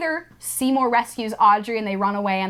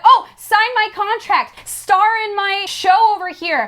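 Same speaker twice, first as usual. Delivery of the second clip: higher, louder pushy pitch; pushy agents; 10 semitones higher, 6 dB louder than usual. Retold or imitated. Agents pushing contracts on Seymour, pushy and high. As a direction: hustle him for a deal.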